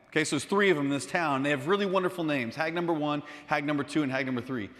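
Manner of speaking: plain reading voice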